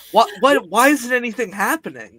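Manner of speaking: laughing confusion. Retold or imitated.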